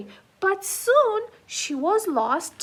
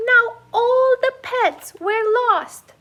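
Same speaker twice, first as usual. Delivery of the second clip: usual speaking voice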